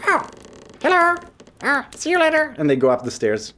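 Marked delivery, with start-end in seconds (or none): 0.1-2.5 very high, tiny creature voice; high squeaky kobold greeting. Acted. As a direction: chirp it like a little kobold.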